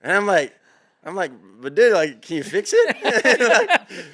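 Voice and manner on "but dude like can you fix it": whiny plea. Retold or imitated. Begging doctor to fix foot.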